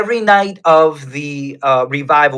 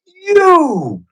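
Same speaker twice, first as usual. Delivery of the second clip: put-on a single high, ringing "You" in a Nigerian accent; the worship leader points and calls out "You" in a Nigerian accent, pitch leaping up; pointed Nigerian-accented call pitched much higher. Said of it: "he goes in his Nigerian accent".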